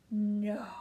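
loud stage whisper. Acted. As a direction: hiss it in disbelief. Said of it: "queen answers".

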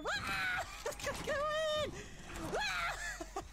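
high screams mixed with laughs; panicked laughing. Real, not acted.

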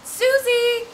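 calling out. Acted. Casey shouting for missing friend Susie. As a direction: shout her name to find her.

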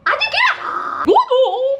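high squeal. Real, not acted. Squealing reaction.

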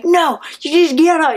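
high-pitched, exasperated retold shout; angry refusal. Refusing the guy with drugs in her room, angrily. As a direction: cut them off with an irate order.

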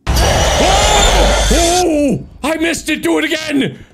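high thrilled squeals; excited shriek. Real, not acted. Ghost activity surprises him.

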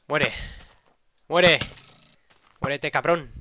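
loud fierce yell; angry shout. Real, not acted. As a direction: snarl it at the enemy. Fighting an enemy.

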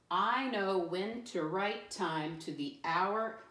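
measured model reading, word by word; slow read-aloud. Shown as a demonstration. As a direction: read slowly and deliberately for a class. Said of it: "let me read my I can statement".

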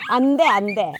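loud sharp no; loud no to a dog; scolding the dog. Real, not acted. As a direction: stop the dog loudly.

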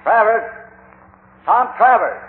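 loud call into a mine shaft, pitched high. Acted. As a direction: shout the name down the tunnel so he hears. Calling into dark mine tunnel.

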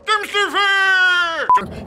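high, slow mocking repeat; mocking echo. Real, not acted. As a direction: stretch it out in disbelief. Echoing insult, high drawn out.

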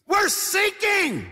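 high, slow, loud cry of panic; panicked shout. Retold or imitated. Captain telling the Coast Guard, drawn-out panicked shout. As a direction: stretch out a desperate yell. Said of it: "and he says".

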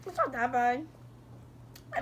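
talking while chewing candy; words muffled by food; mouth full, chewing. Real, not acted. Seen 1: Striped-shirt girl hand at mouth chewing; unclear which girl speaks.

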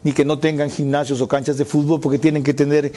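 ordinary talk, nothing marked

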